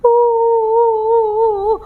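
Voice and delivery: high, drawn-out pretend wail; mock crying "uuh" held high; sad sob. Ciki hurt by teasing, high pretend wail. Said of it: "Ciki pun merasa sedih".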